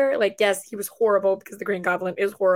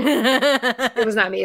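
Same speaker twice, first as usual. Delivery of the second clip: slow, laughing through the words; drawn-out giggly denial; 3 dB louder, much slower than usual. Real, not acted. Friend teases her, she laughs, denying it.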